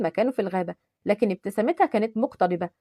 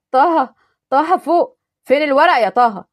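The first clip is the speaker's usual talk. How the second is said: panicked shout; 3 semitones higher, 7 dB louder, slower than usual. Acted. Panicked plea amid the raid.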